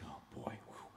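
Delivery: very low, hushed exhale; nervous dread. Retold or imitated. Nervous visitor to himself at the hospital door, hushed dread. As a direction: mutter it softly to yourself, bracing.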